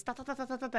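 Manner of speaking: rapid, soft "ta-ta-ta" pulse; imitated racing heartbeat. Patient showing her racing heart rate, imitated rapid thumping. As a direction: rattle off quick, quiet heartbeats. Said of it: "Aí meus batimentos".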